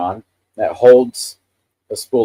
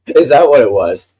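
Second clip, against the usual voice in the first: laughing; 6 semitones higher, 6 dB louder than usual. Real, not acted. Amused by accent remark.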